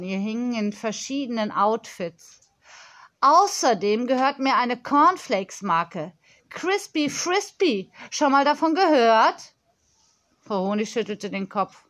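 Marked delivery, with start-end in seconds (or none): 3.2-9.5 put-on higher, showy child voice; proud little businesswoman, pitched up; boastful young girl. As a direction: brag about your own brand.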